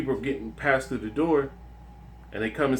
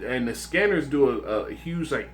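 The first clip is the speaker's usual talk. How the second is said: ordinary talk, nothing marked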